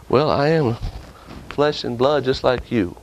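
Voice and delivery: ordinary talk, nothing marked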